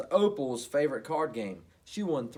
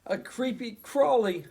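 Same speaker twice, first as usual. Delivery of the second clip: frightened; 9 semitones higher, 8 dB louder, slower than usual. Acted.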